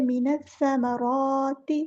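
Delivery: समाराती with रा held one alif long; long vowel on ra. Shown as a demonstration. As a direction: stretch the रा in समाराती. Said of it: "एक अलिफ के बराबर लंबा करेंगे". (रा को एक अलिफ लंबा खींचना)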